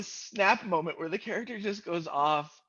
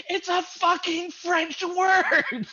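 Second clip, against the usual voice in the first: loud, high and slow, fed up with the question; exasperated mock yell; 13 semitones higher, 7 dB louder, much slower than usual. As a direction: bark it out in exasperation, drawn out. Woman snaps at French student.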